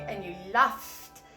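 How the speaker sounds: slow, stretched "laughed"; held F in "laughed". Shown as a demonstration. Teacher returning to the lyric, long F in "laughed". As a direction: linger on the F of "laughed". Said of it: "the F sound".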